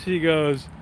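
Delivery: usual speaking voice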